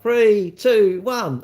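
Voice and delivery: loud high race-start count; shouted start countdown; countdown call. Retold or imitated.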